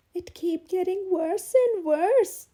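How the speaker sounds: high, loud and slow, on the edge of tears; distressed, near sobbing. Acted. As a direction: let the words break toward a sob. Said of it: "She began to sob".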